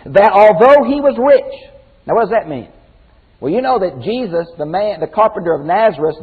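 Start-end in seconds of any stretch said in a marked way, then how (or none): 0.1-1.7 emphatic. Real, not acted. Emphatic reading of scripture.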